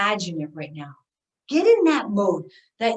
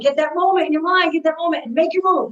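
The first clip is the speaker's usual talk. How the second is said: pumped up; 6 semitones higher, 5 dB louder than usual. Shown as a demonstration. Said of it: "Energy up".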